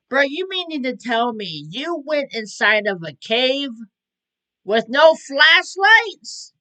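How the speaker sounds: loud, high disbelief, dragging out the last words; incredulous outburst. Real, not acted. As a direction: build to an appalled, stretched-out question. Incredulous reaction to cavers without flashlights.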